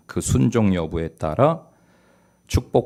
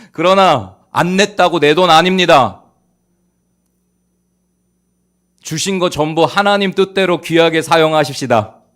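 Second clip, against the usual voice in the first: loud, high pulpit proclamation; ringing, raised-voice exhortation; forceful preaching; 9 semitones higher, 11 dB louder than usual. Real, not acted. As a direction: drive it home to the congregation.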